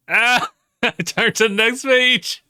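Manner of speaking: very high, loud squeal; excited shout. Real, not acted. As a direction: shriek it in delight. Excited reaction while reading.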